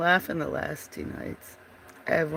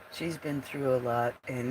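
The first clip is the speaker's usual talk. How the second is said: ordinary delivery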